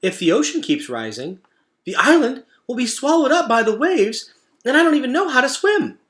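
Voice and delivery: fretful squeak of fear; worried. Acted. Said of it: "eyes grew wide with concern".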